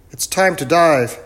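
loud, high professor voice; eccentric professor. Acted. Professor sizes up the young crew, loud and high.

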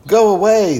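high, loud crowd yell. A narrator's reading. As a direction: drive him off with a shout. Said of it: "they yelled".

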